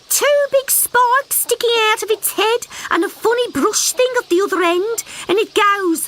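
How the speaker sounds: high little frog voice, eager and breathless; a small frog bursting with news; excited little frog. Acted.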